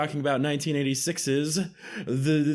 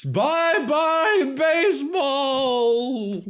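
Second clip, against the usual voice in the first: high loud drawn-out home run call; announcer call; 12 semitones higher, 5 dB louder, much slower than usual. Retold or imitated. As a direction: belt it like a stadium announcer. Imitating baseball announcer call.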